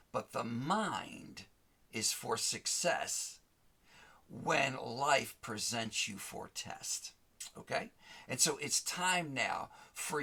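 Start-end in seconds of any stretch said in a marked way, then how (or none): 0.1-7.1 slow, deliberate rhyme. Real, not acted. Preacher rhyming a lesson for listeners, slow and deliberate. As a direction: spell it out line by line. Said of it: "preaching rhyme slowly".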